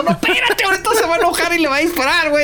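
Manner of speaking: high-pitched, fast, urgent mock alarm; mock frantic warning. Friend spoiling the shooting mid-film, frantic mock warning. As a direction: blurt it out in hammed-up panic.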